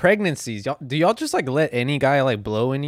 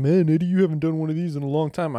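imitated viewer; 6 semitones higher, 4 dB louder than usual. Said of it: "I know what some of you are thinking".

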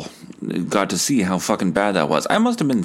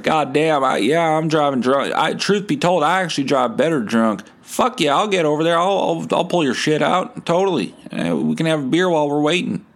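Country Fest guy; 7 semitones higher than usual. Retold or imitated. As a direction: brag like a tipsy tow-truck driver. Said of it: "Country Fest guy's like".

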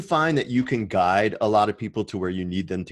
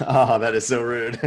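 mock offence through laughter; laughing protest; 2 semitones higher, 4 dB louder than usual. Real, not acted. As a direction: laugh while protesting the tease. Laughs at being teased.

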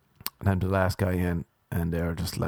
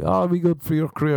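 high, quick imitation of a cheerleader; mock encouragement; 8 semitones higher, 3 dB louder, faster than usual. Imagined adviser sells him on the audition, mock-perky. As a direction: parody someone talking up the job, pitched high. Mimics encouragement about the job.

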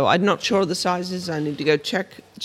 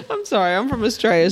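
real laughing apology, high-pitched; 4 semitones higher, 4 dB louder, faster than usual. Real, not acted. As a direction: apologise through helpless giggles. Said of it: "What are you laughing at?".

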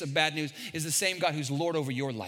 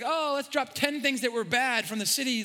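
higher, lively quoting of the doubt voice; mock inner voice; 7 semitones higher than usual. Pastor voices the doubt in his heart, higher. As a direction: voice the nagging doubt. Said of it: "it's like".

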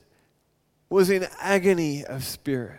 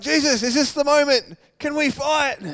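loud, high eager cry; itching for a fight; eager disciples; 8 semitones higher, 5 dB louder than usual. Retold or imitated. Disciple calling to Jesus, loud and eager. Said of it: "They're like".

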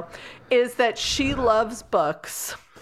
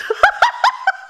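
squealing laugh, much higher and louder; a real laugh breaking out high; high burst of laughter; 6 dB louder than usual. Real, not acted. Cohost cracks up at her own bit with a high squeal.

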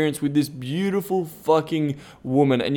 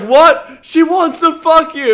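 high, loud, incredulous echo; mock astonishment; 13 semitones higher, 7 dB louder, slower than usual. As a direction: blurt it back in amazement, pitched way up. Retelling astonished reaction to yelled line.

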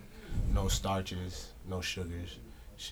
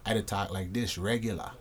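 everyday Saint Thomas speech, low and soft; Saint Thomas accent; 4 semitones lower, 9 dB quieter than usual. Shown as a demonstration. Asked how he talks, showing his Saint Thomas accent. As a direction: talk in your natural Saint Thomas accent. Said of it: "So how you talk?".

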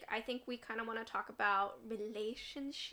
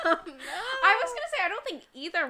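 speaking through laughter, higher; laughing; 9 semitones higher, 12 dB louder than usual. Real, not acted. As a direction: laugh the words out, higher pitched. Laughing after the reveal.

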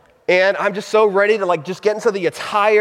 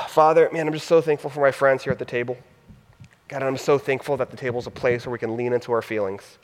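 soft, intimate prayer voice over music; a gentle pastor's prayer, fading softer toward amen; closing prayer; 5 semitones lower, 6 dB quieter than usual. Acted. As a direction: pray quietly for the room.

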